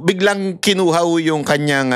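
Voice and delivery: normal talking voice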